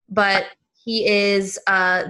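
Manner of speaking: normal talking voice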